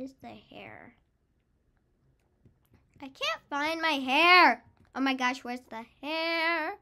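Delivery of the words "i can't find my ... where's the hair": loud wail, drawing out the last word; loud frustrated panic. Real, not acted. Child gamer unable to find the hair, loud panic. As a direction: cry out in a fluster. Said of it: "sorry if I'm screaming".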